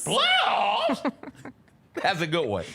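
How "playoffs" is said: Playoffs? shouted much higher, cracking. Retold or imitated. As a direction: shriek it in disbelief. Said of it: "the way the voice cracks".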